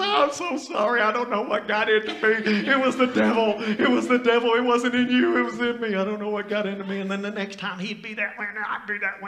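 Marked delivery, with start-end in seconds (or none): 0.0-7.0 higher, louder overdone apology; gushing mock apology. Pastor retells calling his friend back, apologizing loud and high. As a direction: ham up the repentant pastor. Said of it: "I say".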